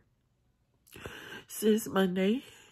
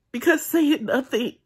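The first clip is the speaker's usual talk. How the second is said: louder and much higher, pushing through hurt; raised emotional voice; 5 semitones higher, 7 dB louder than usual. Real, not acted. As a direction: let it burst out that silence isn't okay. Venting about hurt, voice rising.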